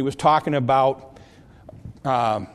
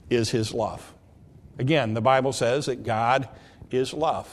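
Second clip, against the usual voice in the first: ordinary talk, nothing marked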